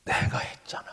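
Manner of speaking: soft, whispery retold answer; the Lord. God answers the pastor about the church's beginnings, hushed reply. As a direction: drop to a hush after a long pause. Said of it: "그렇게 말씀합니다".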